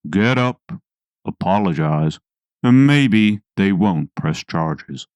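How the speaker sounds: calm and in control, no rush. Retold or imitated. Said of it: "he very calmly said".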